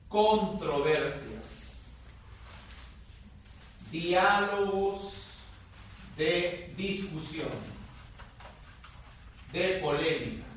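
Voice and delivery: slow, each word set apart; stressed term. Shown as a demonstration. Teacher naming the term for students, slow and deliberate. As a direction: spell out the term slowly for notes. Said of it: "Esto se llama".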